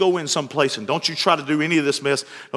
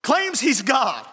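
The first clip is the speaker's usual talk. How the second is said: higher, rousing sermon climax; preaching at full force; 9 semitones higher than usual. Acted. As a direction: lift the congregation with each question.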